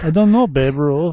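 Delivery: put-on Canadian voice; mock Canadian accent. Host playing a baffled Canadian, mock Canadian accent. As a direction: play a clueless Canadian, accent on. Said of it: "the Canadians were like".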